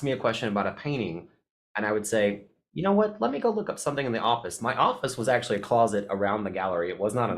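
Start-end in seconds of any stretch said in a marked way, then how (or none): 2.7-4.6 confident. Retold or imitated. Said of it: "telling someone confidently".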